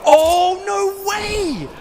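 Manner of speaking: high, loud burst of disbelief; surprised shout. Real, not acted. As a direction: gasp it out in disbelief. Shock at a surprising moment.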